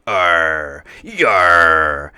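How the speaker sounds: loud, rough pirate bellow; pirate growl. Acted. Captain roaring to his crew, loud pirate bellow. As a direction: belt out a pirate arr.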